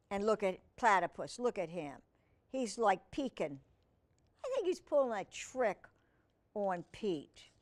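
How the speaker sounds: ordinary delivery, nothing marked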